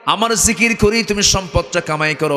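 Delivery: high-pitched sermon delivery; preaching. Acted. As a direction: preach it high and insistent to the congregation. Preaches in raised voice.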